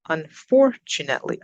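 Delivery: unfortunately with FOR punched; careful demo of un-FOR-tunately; stress on second syllable. Shown as a demonstration. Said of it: "up on the second".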